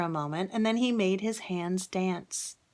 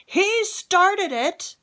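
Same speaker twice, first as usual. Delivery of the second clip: much higher and louder, slow and accusing; king crying out; 13 semitones higher, 8 dB louder, much slower than usual. Acted. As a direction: blame the dragon loudly. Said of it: "he cried".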